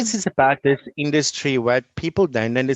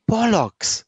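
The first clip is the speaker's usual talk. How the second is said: higher, punchy Bollocks; blunt exclamation; 7 semitones higher than usual. Real, not acted. Teacher dismissing excuses with a blunt exclamation. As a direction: spit out the dismissal. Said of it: "Sorry for the word".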